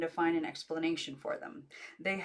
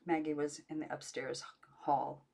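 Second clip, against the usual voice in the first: ordinary delivery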